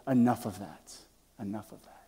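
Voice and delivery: soft, fading self-dismissal; collecting himself after tears. Acted. Old man brushing off his tears, hushed. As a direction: quietly pull yourself back together. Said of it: "he wiped away the tears".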